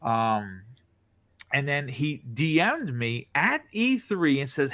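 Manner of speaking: ordinary delivery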